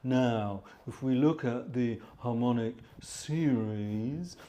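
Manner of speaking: very slow, quiet Cockney lecturer; ponderous slow-motion Cockney drawl; Clive Grated. Retold or imitated. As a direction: drag it out like a posh Cockney sloth. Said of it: "he would talk like that".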